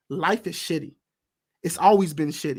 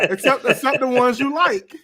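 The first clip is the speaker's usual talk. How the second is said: laughing tease; 7 semitones higher, 5 dB louder than usual. Real, not acted. Teasing jab, laughing.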